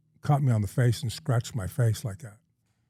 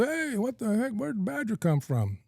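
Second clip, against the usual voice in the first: high, drawn-up mock amazement; imitated astonished greeting; 11 semitones higher than usual. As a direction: imitate an outfitter calling out in surprise. Outfitter acted as astonished arrival.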